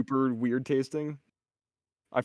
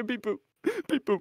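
robot beeps; 9 semitones higher than usual. Acted. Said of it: "he doesn't speak Eng".

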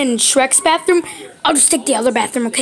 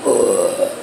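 low rumbling belch; burp. Acted. Burper scolding himself to stop, low burp. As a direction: belch low against your will. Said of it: "stop burping".

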